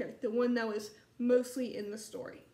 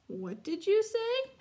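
gentle mother; 8 semitones higher than usual. Acted. Mother gently asking Chester.

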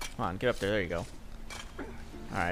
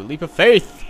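called out; 6 semitones higher, 11 dB louder, slower than usual. Real, not acted. Calling out the jump.

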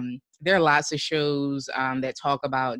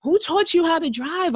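mock road rage; 4 dB louder than usual. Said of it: "feel such rage while driving".

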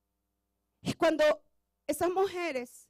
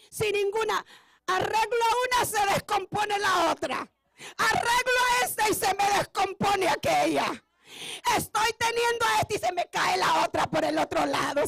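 exasperated complaint; 5 semitones higher, 4 dB louder than usual. Retold or imitated.

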